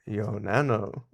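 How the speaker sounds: soft mimicry of a creepy TTS voice; creepy TTS imitation. Streamer puzzled by the donation alert, quiet TTS imitation. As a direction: lightly copy the odd text-to-speech delivery, quietly. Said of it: "Why did it say it like that?".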